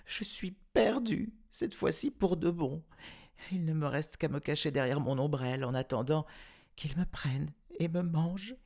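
put-on high, fast frightened child; little girl sure the tiger will eat her; terrified girl. A narrator's reading. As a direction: gabble it out in panic.

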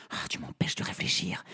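fast breathless whisper. Acted. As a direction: hiss it under the breath. Princess muttering.

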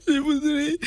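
crying on the phone. Acted. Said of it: "pourquoi vous pleurez?".

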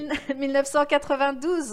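loud, high, laughing reply. Real, not acted. Guest tells the host which year it was, laughing.

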